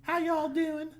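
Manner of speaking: high, mocking Southern greeting; Southern accent. Mimicking friend coming back from visiting his dad, in Southern accent. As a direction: ham up a Southern hello. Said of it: "you'd be like".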